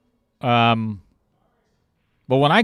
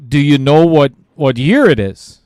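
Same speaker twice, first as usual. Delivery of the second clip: a louder, careful question; 7 dB louder than usual. Retold or imitated. Friend loudly quizzing the concussed player. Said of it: "He's like".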